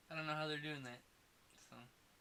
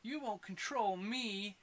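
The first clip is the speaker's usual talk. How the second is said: mock wooden, slow and higher delivery; robotic actress line; 6 semitones higher, much slower than usual. Mocking the actress's bad line for his cohost, slow and robotic. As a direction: parody a stiff actress, dragging it out. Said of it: "she sounded like a robot".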